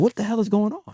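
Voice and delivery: high, baffled question; what is going on, high and puzzled; confused surprise. Retold or imitated. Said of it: "So I'm like".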